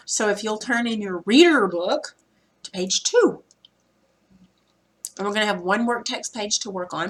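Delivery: ordinary delivery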